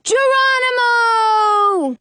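long, high, slow shout; hollering. Acted. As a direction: launch it as you jump. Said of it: "And then he hollers".

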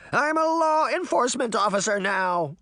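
high-pitched frightened bluster; shouting, mustering courage. A narrator's reading. Deputy standing up to the Horseman, shouting with mustered courage. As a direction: shout bravely in a high, scared voice. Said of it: "Crane shouted, trying to muster all his courage".

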